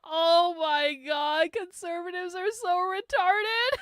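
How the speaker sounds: high, strained laughing through the words; half-crying with laughter at them; laughing disbelief. Real, not acted. Streamer mocking conservatives, laughing through the words.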